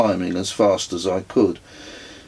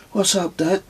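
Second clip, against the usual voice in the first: put-on higher child's voice, curious; son's voice; 11 semitones higher than usual. A narrator's reading. Son calling to his dad, higher child's voice. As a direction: voice the boy asking his dad.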